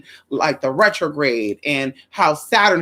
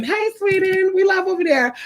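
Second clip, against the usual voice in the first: calling out; 13 semitones higher than usual. Real, not acted. Shouting greetings to Sweden excitedly. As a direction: call out to Sweden.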